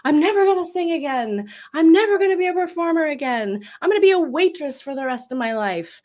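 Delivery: high, loud cry of giving up; despairing outburst. Retold or imitated. Telling the host how she gave up, a loud high despairing cry. Retelling her own despairing bargains.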